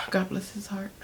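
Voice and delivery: breathy whispered aside on a sigh. Real, not acted. Storyteller pitying the guy she met, sighed whisper. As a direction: sigh it out, barely voiced.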